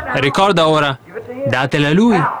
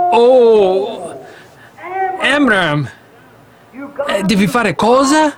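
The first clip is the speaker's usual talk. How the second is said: high, pleading wife's voice, raised pitch; a horrified mother begging her husband; alarmed wife; 8 semitones higher, much slower than usual. Acted. Jochebed begging her husband, high and alarmed.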